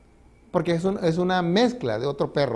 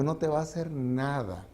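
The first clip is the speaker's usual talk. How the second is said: calm reassurance; 3 semitones lower, 9 dB quieter than usual. Retold or imitated. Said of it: "Le digo".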